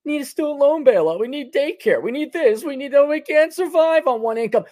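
high mocking list of demands; mocking whine.

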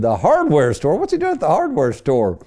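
retold disbelief, pitch jumping up; incredulous. Questioning the framer about the missing tech, incredulous.

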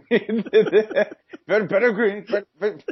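high-pitched, stuttering coach impression; Jimbo Fisher. Mocking a coach's verbiage with hiccups, high stuttering impression. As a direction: trip over the words in a high hiccupy voice. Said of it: "It's like Jimbo Fisher with hiccups".

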